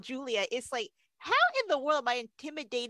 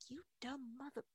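muttered insult; 19 dB quieter than usual. Retold or imitated. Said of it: "D J is like".

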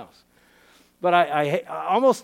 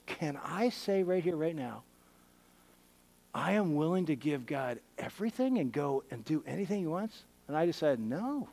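soft confession; 6 dB quieter than usual. Real, not acted. Confessing his hidden inner struggle.

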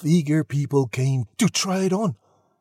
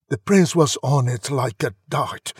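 ordinary delivery, nothing marked

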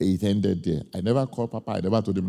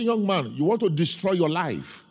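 put-on raised, stern warning to a young man; stern rebuke; 8 semitones higher than usual. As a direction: scold him like an angry elder. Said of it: "Then I got mad".

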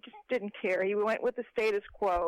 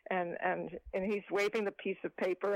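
ordinary delivery

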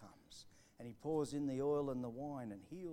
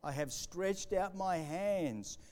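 loud, slow proclaiming of scripture; emphatic scripture reading; 4 semitones higher, 8 dB louder, much slower than usual. Acted. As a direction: declaim the verse slowly, with weight. Reading scripture aloud with force.

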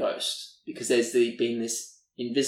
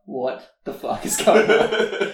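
baffled disbelief; 6 semitones higher, 4 dB louder, slower than usual. Retold or imitated. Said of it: "they're just like".

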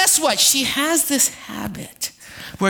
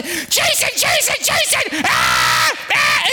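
panicked cries; 8 semitones higher, slower than usual. Retold or imitated. Wife yelling for her husband after slicing her finger, mock panicked shriek.

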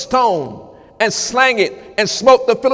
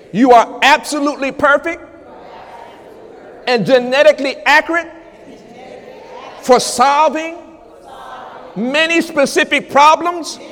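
preaching; 4 semitones higher, 3 dB louder than usual. Acted. Preacher climbs to full force.

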